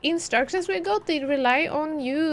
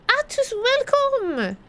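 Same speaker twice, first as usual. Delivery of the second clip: excited welcome; 11 semitones higher, 5 dB louder, much slower than usual. Real, not acted. Greeting a new viewer.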